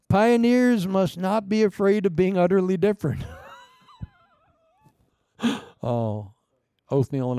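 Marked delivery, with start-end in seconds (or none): 0.1-3.2 shouted point. Real, not acted. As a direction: proclaim it to the whole room. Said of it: "shouted sermon point".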